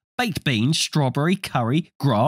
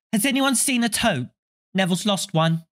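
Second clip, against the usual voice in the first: put-on bossy girl; 9 semitones higher than usual. A narrator's reading. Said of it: "She had a bossy sort of voice".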